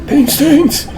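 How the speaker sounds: high, squeaky disbelief; shrill surprised question. Acted. Voicing surprised witness.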